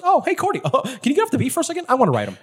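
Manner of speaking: high, fast, chirpy put-on voice; jaunty high character voice; Jarvis voice. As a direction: breeze through it in a high comic voice. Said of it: "Jarvis goes".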